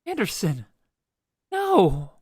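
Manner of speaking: high pained cry of dismay at a rival's pick; anguished groan over a stolen pick; high dismayed wail. Real, not acted.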